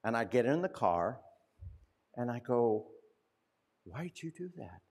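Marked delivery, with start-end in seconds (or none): 3.8-4.8 put-on softer, hushed curious kid's voice; hushed child's question. Nine-year-old quietly asking grandma in the car, hushed. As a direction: ask it softly like a puzzled nine-year-old.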